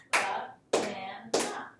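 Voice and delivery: Banana slowed right down, ba-na-na, each syllable hit; clapped out syllables. Shown as a demonstration. Teacher claps out Banana syllable by syllable for the class. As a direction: clap out Banana beat by beat. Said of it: "pace out the sounds with your hands".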